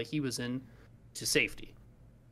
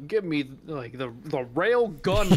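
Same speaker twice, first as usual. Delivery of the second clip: quoting the character; 3 semitones higher, 8 dB louder, slower than usual. Retold or imitated. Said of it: "where he said something about".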